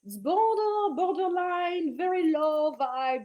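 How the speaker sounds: high, loud, drawn-out put-on voice; mock disapproval. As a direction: stretch it out in exaggerated judgement. Mockingly rating self-abandoning behaviour.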